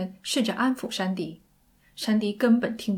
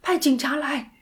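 raised, higher-pitched urgent shout; 6 semitones higher, 4 dB louder than usual. Acted. Sandy to emergency operator demanding police, shouting. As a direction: cry out for police, loud and high. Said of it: "山迪大叫着".

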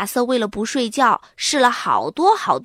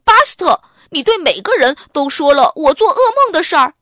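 loud, high angry outburst; angry accusation; 6 semitones higher, 7 dB louder, faster than usual. Acted. Arthur angrily confronting Buster for telling everyone, loud. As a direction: confront him furiously. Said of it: "气鼓鼓地说".

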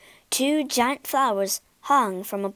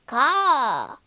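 croaking crow caw; 3 semitones higher than usual. Acted. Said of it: "he croaked".

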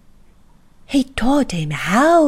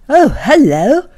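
louder, surprised grown-up voice; Mister Robinson's voice; 6 semitones higher, 9 dB louder than usual. A narrator's reading. Mister Robinson spotting the tiny man, loud. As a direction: boom out a surprised hello.

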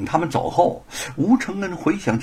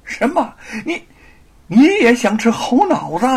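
high, loud angry disbelief; angry outburst; 9 semitones higher, 6 dB louder than usual. Acted. Scholar angered by the thief's confession, loud outraged question. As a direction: flare up in outrage. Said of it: "怒气上涌".

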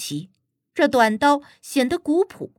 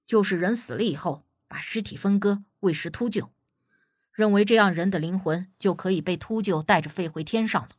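put-on low, subdued older-hand explanation; captain explaining; 4 semitones lower, 3 dB quieter than usual. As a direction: explain reluctantly in a lower, quieter voice. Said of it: "刘队长干咳了一声，皱了皱眉，给小贾解释说".

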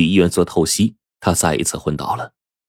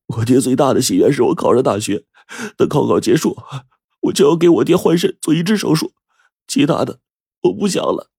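higher-pitched voice of a determined student; earnest student character; 8 semitones higher than usual. A narrator's reading. Student telling teacher he will give his father a kidney, earnest. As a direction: voice an earnest student, pitched well above the narration.

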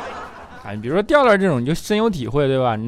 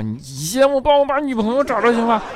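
much higher laughing retort; a son talking back with a laugh; defiant son; 11 semitones higher, 3 dB louder than usual. Retold or imitated. Son retorts to dad about finding a girlfriend, high laughing. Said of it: "李孝钦就不服啊，说".

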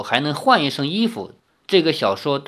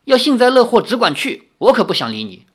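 raised, irritated brush-off; angry retort; 7 semitones higher, 4 dB louder than usual. Acted. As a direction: round on them in anger. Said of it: "李逍遥转身怒道".

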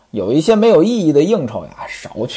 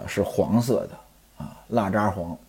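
usual speaking voice

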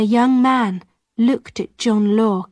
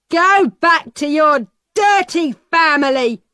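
angry shout; 5 semitones higher, 4 dB louder than usual. A narrator's reading. Peddler shouting at Alison.

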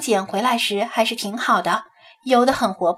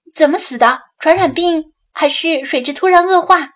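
higher, louder, insistent questioning; pressing questions; 5 semitones higher, 6 dB louder than usual. A narrator's reading. Pressing to know how the fish died, insistent. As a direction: press urgently for the cause. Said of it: "蒋菲菲追问道".

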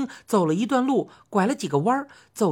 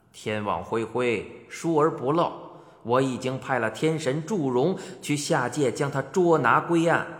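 低沉缓慢的威严神君; 压低嗓子摆出帝王架子; 威严的天帝; 9 semitones lower than usual. Acted. 天帝训话审问. (低沉威严的天帝腔)

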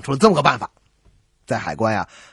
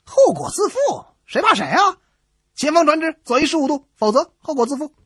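defiant retort; 11 semitones higher than usual. Acted. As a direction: throw the threat straight back. Ship captain defiantly answers back.

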